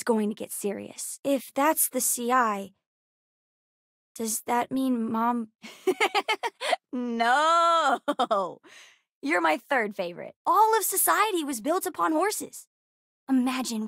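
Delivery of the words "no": laugh, then a drawn-out no; amused, long-held no; giggling denial. Acted. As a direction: giggle and stretch out the no.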